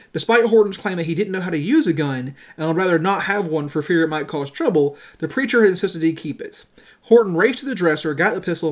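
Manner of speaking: ordinary talk, nothing marked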